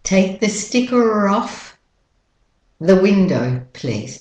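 slow British-Australian linking R in "sticker off"; British-Australian model sentence; British-Australian accent. Shown as a demonstration. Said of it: "So you have to link it".

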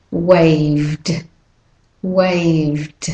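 "waved" ending in a voiced d, one syllable; ending d sound. Shown as a demonstration. Teacher modelling final d in "waved". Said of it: "then the E D says d".

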